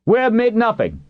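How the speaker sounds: high-pitched defiant shout; shouted denial. A narrator's reading. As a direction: shout the refusal, voice way up. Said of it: "just as the wineman shouted".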